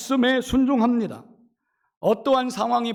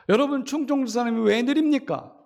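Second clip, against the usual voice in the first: normal talking voice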